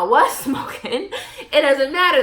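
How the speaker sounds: real laughter through the words; giggling admission; laughing. Real, not acted. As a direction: crack up mid-confession. Laughs admitting she was smoking.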